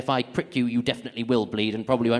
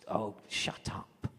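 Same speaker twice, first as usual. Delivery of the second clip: whisper; 5 semitones lower, 12 dB quieter, much slower than usual. Retold or imitated. Inner thought, whispered.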